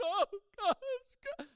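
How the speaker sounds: very loud, high, sobbing wail; hammed-up imitation of tearful prayer; crying out in tears. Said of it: "By this time, I'm crying".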